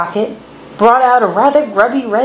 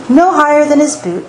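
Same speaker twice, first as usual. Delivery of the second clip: ordinary delivery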